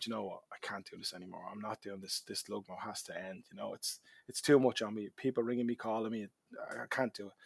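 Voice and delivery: low, soft self-talk; retold weariness. Knackered father telling himself he must quit, low weary voice. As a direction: mutter it to yourself as if worn out. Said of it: "I was knackered, and I said to myself".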